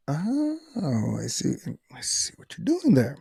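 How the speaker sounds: whispered aside, catching on; knowing whisper. Retold or imitated. To the lie detector examiner, whispered knowing aside. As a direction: breathe it out like you've spotted the trick.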